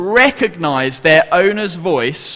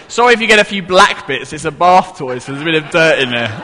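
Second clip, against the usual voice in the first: ordinary delivery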